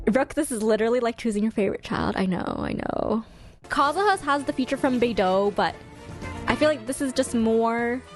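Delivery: normal talking voice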